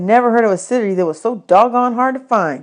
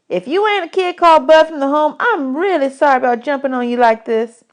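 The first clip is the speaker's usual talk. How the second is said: lightly voiced boy, higher; a hint of a scrappy kid apologizing; Bugs, a boy; 6 semitones higher than usual. A narrator's reading. Bugs apologizing to Bud after jumping him, light boy voice.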